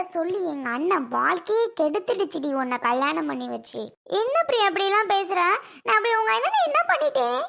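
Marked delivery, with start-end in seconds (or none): 5.8-7.4 high, sharp cry of what did I do; hurt and indignant, pitched up; indignant protest. Acted. Wife protests to her sister-in-law, high indignant cry.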